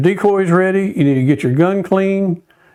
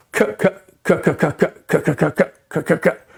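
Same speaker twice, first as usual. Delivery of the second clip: single cut feed call; 3 dB quieter than usual. Shown as a demonstration. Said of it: "the way you do a single cut is just a".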